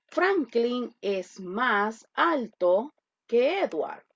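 slow, drawn-out model sentence; slow dictation. Shown as a demonstration. Teacher dictating slowly to the class. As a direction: dictate each word slowly. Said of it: "Yo quiero decir".

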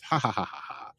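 spoken-out fake villain laugh, trailing off; mock gloating laugh. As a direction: imitate a villain's pleased "ha-ha".

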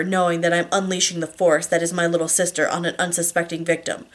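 ordinary delivery

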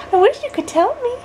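put-on high baby talk. Mom cooing to baby.

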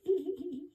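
high little giggle. Shown as a demonstration. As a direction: giggle brightly on a rise.

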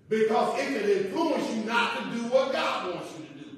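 emphatic, loud preacher's delivery; forceful preaching. Real, not acted. Pastor warning the congregation about bad influences, forceful preaching. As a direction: press each phrase on the congregation.

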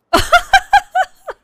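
loud, high-pitched laughing fit; helpless laughter. Real, not acted. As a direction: burst out laughing hard. Laughing fit at game glitch.

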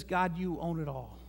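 a fervent prayer of trust. Acted. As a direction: pray it with confident trust. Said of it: "It says".

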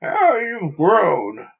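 loud, slow, hearty exclamation; loud jovial. Acted. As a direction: boom it out like a proud father. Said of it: "in that loud, jovial voice".

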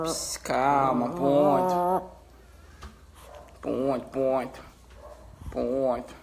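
low, slow, drawn-out hushing; soft, tender reassurance; soothing a pet. Real, not acted.

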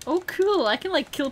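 high, loud delighted cool; thrilled exclaim; excited. Real, not acted. Delighted discovery.

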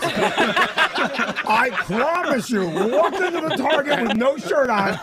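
can barely get it out for laughing; laughing, slow and high. Real, not acted. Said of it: "punchline delivered laughing".

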